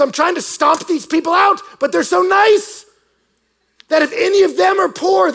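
high-pitched exasperated rant, loud then easing; hammed-up frustrated ruler; frustrated emperor. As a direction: protest in helpless, whining outrage. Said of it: "he wrote to one of his men in frustration".

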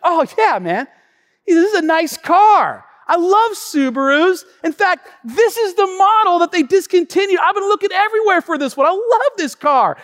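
high, loud fan of the car; hammed-up delighted valet; acted gushing enthusiasm. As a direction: gush over a car you adore.

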